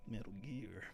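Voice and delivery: light gravelly soldier voice; a hint of Solid Snake. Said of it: "impressions of Snake".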